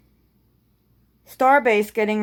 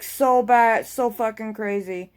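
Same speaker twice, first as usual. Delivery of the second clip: ordinary delivery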